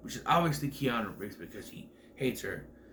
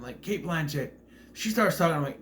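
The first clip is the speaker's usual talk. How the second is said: ordinary talk, nothing marked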